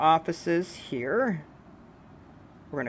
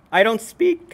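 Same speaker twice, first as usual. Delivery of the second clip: voice cracking; 6 semitones higher, 6 dB louder than usual. Real, not acted. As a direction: voice cracks mid-phrase. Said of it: "my voice just cracked".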